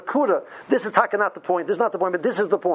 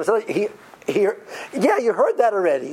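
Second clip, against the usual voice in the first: usual speaking voice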